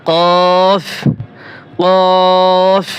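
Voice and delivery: কফ held long from the back of the throat; drawn-out letter. Shown as a demonstration. Teacher drilling students on a long কফ. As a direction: stretch কফ out for three beats. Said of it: "এখানেও তিন আলিফ টান হবে।".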